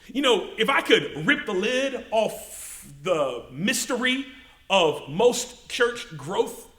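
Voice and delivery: ordinary talk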